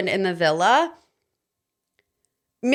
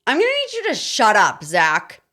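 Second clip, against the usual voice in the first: exasperated shut-up. Real, not acted. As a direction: cut him off, fed up. Telling Zach off.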